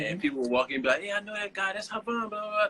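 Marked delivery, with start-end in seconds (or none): 1.0-2.7 imitated excited passersby. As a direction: lightly mimic people spotting someone they know, pitched up. Imitating passersby who recognise him.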